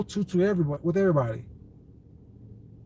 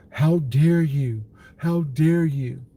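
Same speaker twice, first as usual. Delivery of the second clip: mock outrage; 4 dB louder, much slower than usual. Mocking offended critics.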